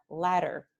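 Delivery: ladder with a single quick tap for dd; American flap. Shown as a demonstration. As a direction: flick the tongue once on the dd of ladder. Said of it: "pronounced with this sound".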